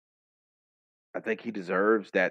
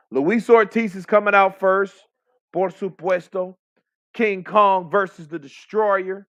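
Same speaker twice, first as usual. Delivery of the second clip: high, loud ring-announcer hype; pitched-up fight-night introduction; announcer call; 10 semitones higher, 8 dB louder than usual. Real, not acted. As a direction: announce the walkout to the fans.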